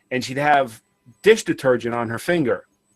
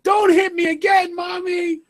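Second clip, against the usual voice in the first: put-on child wailing. Kid wailing on store floor.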